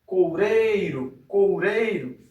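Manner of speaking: "Coureiro" with the middle syllable "rei" stretched long and strong; "coureiro" said slowly, emphasising its strongest syllable; stressed syllable drawn out. Shown as a demonstration. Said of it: "onde aparece a sílaba mais forte".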